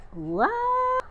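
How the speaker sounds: high, drawn-out astonished squeak; wide-eyed, high-pitched disbelief. Retold or imitated. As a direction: let "what" soar up in amazement. Retelling shocked reaction to driver.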